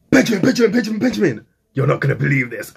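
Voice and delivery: high, loud calls of a name; bursting into a brother's room with news; excited calling. Retold or imitated. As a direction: shout for the brother in a rush. Running upstairs calling brother.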